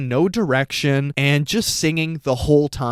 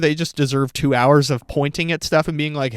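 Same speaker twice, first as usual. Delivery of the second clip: ordinary talk, nothing marked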